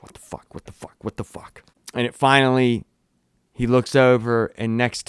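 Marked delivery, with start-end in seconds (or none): 0.0-1.6 breathless frightened whisper; panicked whisper. Retold or imitated. Batman surrounded by the owls, panicked whisper. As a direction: whisper it as walls close in.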